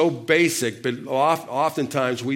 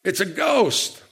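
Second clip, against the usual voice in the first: higher-pitched imitation of a fearful cry; retold cry of fear; mock frightened cry; 7 semitones higher, slower than usual. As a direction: cry it out as if terrified, without shouting. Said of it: "they cried out".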